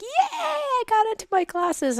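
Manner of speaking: high-pitched retold celebration; son's excited cheer. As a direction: imitate a thrilled son cheering. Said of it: "and then he's like".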